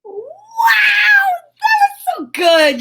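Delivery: high, loud delighted shout; excited cheer. Real, not acted. Host cheering kids after the count, loud delighted shout.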